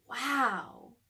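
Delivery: usual speaking voice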